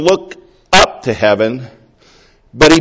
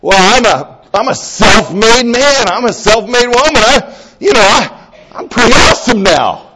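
puffed-up, louder self-praise; smug boast; 7 semitones higher, 4 dB louder than usual. Retold or imitated. A self-made believer boasting about himself, smug. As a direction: brag about being self-made, high and pleased. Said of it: "and you sorta".

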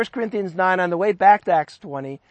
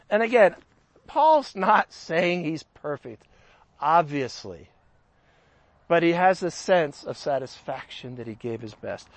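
ordinary talk

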